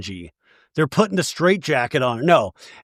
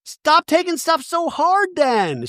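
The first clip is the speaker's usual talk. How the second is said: much higher, hammed-up exasperated plea; imitating Mary; 13 semitones higher, slower than usual. Mary pleading with Angie, high mock exasperation. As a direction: beg like a worn-out friend. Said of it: "Mary's like".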